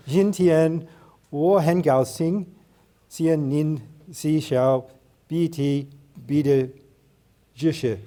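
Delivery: slow, careful Mandarin with an English accent; English speaker reading out learner Mandarin. Real, not acted. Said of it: "really bad, uh, Chinese accents".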